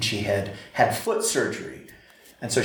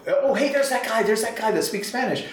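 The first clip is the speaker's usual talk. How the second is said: imitating the lady's much higher calling voice; 11 semitones higher than usual. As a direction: pitch way up to call him over. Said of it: "she would call me over".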